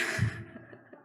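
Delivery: little unvoiced laugh. Real, not acted. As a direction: laugh under your breath. Laughs at own joke.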